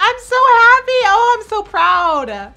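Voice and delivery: screaming very high and loud, slow and near tears; overjoyed shout. Real, not acted. Shouting joyfully as her favourite makes the cut. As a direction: cry out in joyful disbelief.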